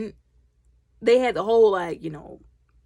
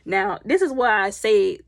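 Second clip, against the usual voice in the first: ordinary delivery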